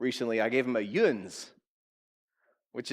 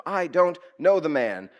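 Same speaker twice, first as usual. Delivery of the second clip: sworn denial; 3 semitones higher, 7 dB louder, slower than usual. Acted. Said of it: "he swore to them".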